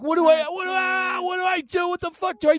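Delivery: high, loud crying shout of panic; panicked pain. Retold or imitated. Boy burned by the spray, a panicked crying shout. Retold agony after spray.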